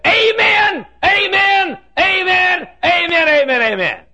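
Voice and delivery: piercing high shouts, falling at the end; hollered amens. Retold or imitated. Little fellow looking up at the preacher, high hollering. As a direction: imitate the man's high, loud holler. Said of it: "And he'd holler".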